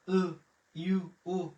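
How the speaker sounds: ordinary delivery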